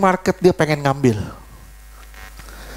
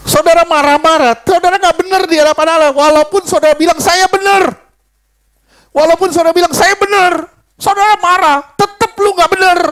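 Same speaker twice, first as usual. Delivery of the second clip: louder and higher, preaching at full force; fiery rebuke; 7 semitones higher, 4 dB louder than usual. Acted. Preacher thunders at the congregation about anger, loud and high. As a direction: hammer it home to the congregation.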